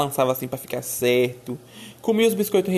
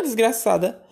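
normal talking voice

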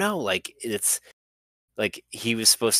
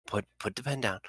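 coaxing a dog; 5 semitones higher than usual. Acted. Handler getting the president to drop the pen, careful coaxing. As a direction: gently talk him out of it, as to a pet.

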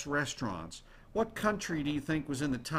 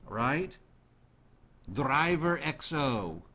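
Italian-ish accent; slower than usual. Shown as a demonstration. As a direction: roll it out like an Italian. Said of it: "I kind of sounded Italian doing that".

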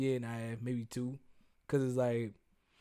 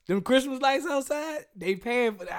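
higher, indignant voice; high mock outrage; 10 semitones higher, 6 dB louder than usual. Mock complaint about bills.

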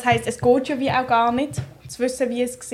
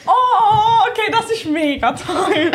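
delighted; 8 semitones higher, 4 dB louder, slower than usual. Real, not acted. Delighted reaction to gift.

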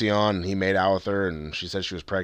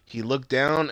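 normal talking voice

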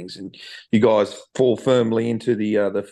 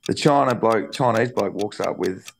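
normal talking voice

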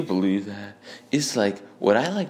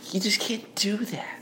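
whispered driver voice; 11 semitones higher, 3 dB quieter than usual. Retold or imitated. Driver quoted.

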